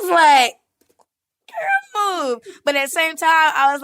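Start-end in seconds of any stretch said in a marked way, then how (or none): 1.5-2.4 put-on high squeaky girl move; mock brush-off. Aunt jokingly pushing her niece off the bed, high and squeaky.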